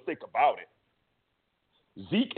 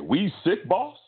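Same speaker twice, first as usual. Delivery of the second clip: much higher, fawning mock plea to the boss; a higher, fawning mock voice echoing the master; servile house slave; 7 semitones higher, 3 dB louder, slower than usual. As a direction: imitate a servant eagerly echoing his master.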